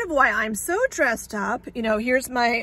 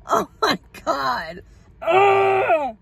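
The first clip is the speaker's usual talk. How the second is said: strained, higher cry of exasperation; frustrated cry; 6 semitones higher, 3 dB louder, much slower than usual. Real, not acted. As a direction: let the frustration burst out. Frustrated outburst after rock.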